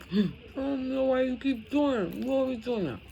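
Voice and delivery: put-on high, teary child's complaint after a gasp; tearful child. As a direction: plead through tears like a little girl. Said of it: "I'm crying".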